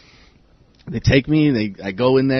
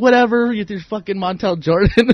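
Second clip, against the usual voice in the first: high, dismissive taunt; 8 semitones higher than usual. Retold or imitated. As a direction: brush them off mockingly. Taunting inmates, retold.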